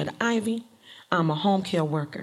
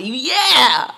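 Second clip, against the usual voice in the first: loud, higher, drawn-out whoop; triumphant cheer; 4 semitones higher, 8 dB louder than usual. Retold or imitated. Celebrating with coworkers about the meeting, triumphant shout. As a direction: let out a re-enacted victory cheer.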